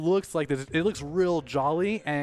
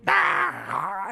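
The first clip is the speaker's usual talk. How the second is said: loud, higher mock roar; heads' dying cry; 4 semitones higher, 4 dB louder than usual. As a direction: cry out as the blast hits. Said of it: "suddenly the heads go".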